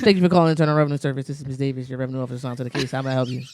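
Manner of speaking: fast formal phone greeting. Pretend. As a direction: rattle off a polite phone line. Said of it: "That's how I answer the phone".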